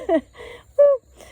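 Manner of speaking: high, loud emotional laugh and whoop; overcome "woo". Real, not acted. Speaker overwhelmed by God's presence, high emotional "woo". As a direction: let out a high woo to steady yourself. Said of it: "I'm gonna cry".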